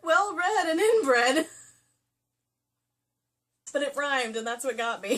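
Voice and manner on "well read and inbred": "Well-read and inbred" slow, loud, rising on "inbred"; relishing the rhyme. Pretend. Savouring the rhyme.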